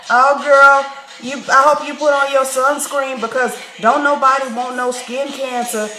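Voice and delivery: hammed-up barista giving sunscreen advice; put-on sassy barista. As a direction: parody her forced vernacular. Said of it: "She said that".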